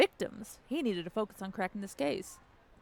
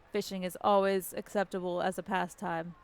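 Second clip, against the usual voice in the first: ordinary talk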